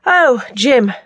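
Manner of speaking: offhand dismissal of someone unimportant; dismissive. A narrator's reading. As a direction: wave the name away dismissively. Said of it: "dismissed Bert".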